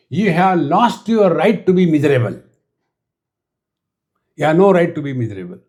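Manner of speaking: normal talking voice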